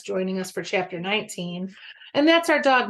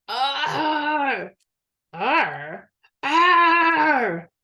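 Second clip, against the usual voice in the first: groaning; 7 semitones higher, 3 dB louder, much slower than usual. Acted. Tom groans on his back.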